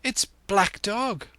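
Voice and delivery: put-on high, alarmed boy's call; urgent cry to stop someone. A narrator's reading. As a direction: raise the alarm, pitch high. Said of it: "I cried".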